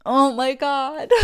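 slow, loud, laughing delight; laughing with joy. Acted. New parents-to-be celebrating, laughing joy. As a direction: laugh through it, overjoyed.

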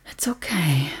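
low, breathy whisper; whispered reassurance. Acted. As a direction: whisper it close to soothe. Comforting upset partner.

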